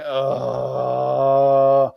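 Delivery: drawn-out sleepy groan; groggy groan. Retold or imitated. Kid lying in bed at six, groaning. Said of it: "lying there going".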